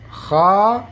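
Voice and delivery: "Kha" with a throaty rasping flow; long scraped KH in "Kha"; vibrating kha. Shown as a demonstration. Teacher contrasting two letters, "Kha" rasped and flowing. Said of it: "dia seperti ada getaran".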